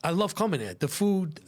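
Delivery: usual speaking voice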